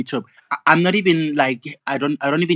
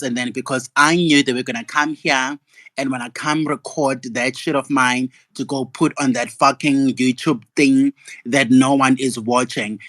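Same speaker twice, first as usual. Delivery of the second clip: ordinary talk, nothing marked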